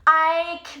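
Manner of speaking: ordinary talk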